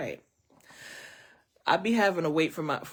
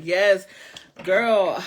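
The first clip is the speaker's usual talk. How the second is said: loud excited agreement; 3 semitones higher, 10 dB louder than usual. Real, not acted. Agreeing loudly with viewer.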